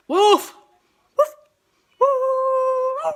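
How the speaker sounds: high loud barking; yappy little dog woofs; high excited dog barks. Shown as a demonstration. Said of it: "What is this?".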